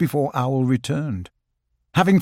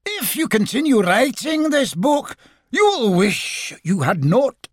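Rabbit; 10 semitones higher than usual. A narrator's reading. Disguised chimney voice threatening Owl over his book, ghostly. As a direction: warn him in a failing ghost disguise. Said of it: "now sounding quite like Rabbit".

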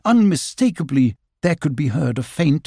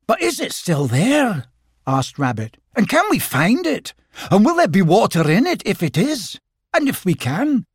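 much higher, rapid-fire questions; anxious rabbit; 7 semitones higher than usual. Acted. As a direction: pepper them with worried questions.

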